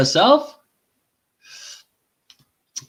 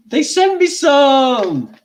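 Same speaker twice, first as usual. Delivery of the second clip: excited; 12 semitones higher, 6 dB louder, slower than usual. Real, not acted. Excited about brownies.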